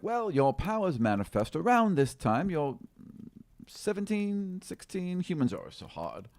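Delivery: high, bright-eyed halfling rambling; a fussy little halfling guessing ages, pitched high; chatty halfling. Acted. Halfling to the young girl, high and fussy. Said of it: "the halfling is like".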